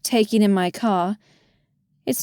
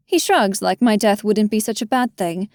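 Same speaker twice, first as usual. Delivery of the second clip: ordinary delivery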